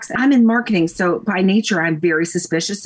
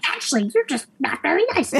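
mock-arrogant British correction, higher pitched; super arrogant; 9 semitones higher, 4 dB quieter than usual. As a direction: put on a haughty husband's voice. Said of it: "like, super arrogant".

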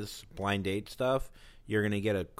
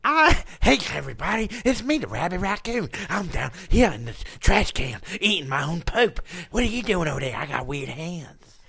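much higher, louder manic raccoon; raccoon character; 5 semitones higher, 9 dB louder than usual. Pretend. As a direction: squeal it like a gleeful trash raccoon. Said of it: "you do a raccoon".

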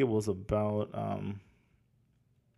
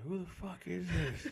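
quiet, slow, laughing, high-pitched; 6 semitones higher, 9 dB quieter than usual. Retold or imitated. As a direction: laugh through a mock rude answer. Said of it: "I was like".

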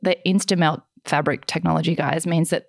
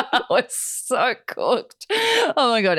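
laughing; 6 semitones higher, much slower than usual. Real, not acted. Laughing at their blooper.

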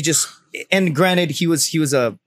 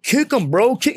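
higher-pitched bystander urging; imitated egging-on; 8 semitones higher, slower than usual. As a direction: goad him like an excited onlooker.

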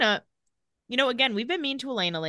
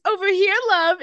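higher, louder mock British call; British accent; 11 semitones higher, 7 dB louder than usual. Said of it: "in, like, a British accent".

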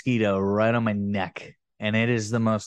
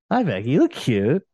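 ordinary talk

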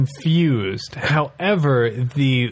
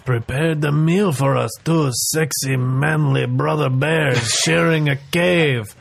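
woo her as a smarmy bear; seductive owl bear. Acted. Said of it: "you are throwing your voice".

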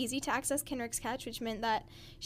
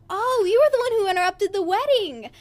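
loud, high exclamation of realization; sudden recognition; 8 semitones higher, 13 dB louder than usual. Acted. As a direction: blurt it out as the memory clicks. Acts out book character's sudden recognition.

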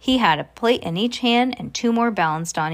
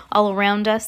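plain reading voice

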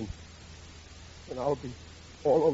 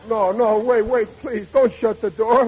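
louder, higher, urgent begging; desperate plea; 5 semitones higher, 6 dB louder than usual. Acted. Ragged father begging the butler at the mansion door, loud urgent plea. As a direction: stop the door before it shuts.

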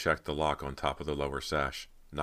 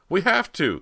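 high, loud insistence; forceful declaration; 10 dB louder than usual. A narrator's reading. Cody overrules Rob's doubt, high loud insistence. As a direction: cry it out with total determination. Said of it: "Cody declared".

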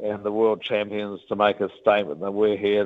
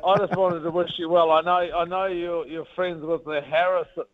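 higher, chatty, a bit hesitant caller on the phone; imitating a friendly Kiwi fan; 7 semitones higher than usual. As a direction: play the eager local fan ringing to wish luck. Retold phone call from fan.

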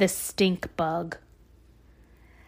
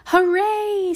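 excited cheer; 12 semitones higher, 5 dB louder than usual. Acted. Characters cheer.

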